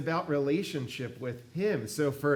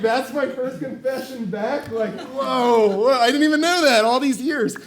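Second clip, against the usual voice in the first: disbelief; 9 semitones higher, 9 dB louder than usual. Retold or imitated. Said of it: "I'm like".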